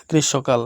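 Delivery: গ্রীষ্মকাল as "grishshokal"; "sho" in place of "shmo" in গ্রীষ্মকাল; silent M. Shown as a demonstration. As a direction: repeat গ্রীষ্মকাল with the ম unsounded. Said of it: "ময়ের উচ্চারণটা হবে না".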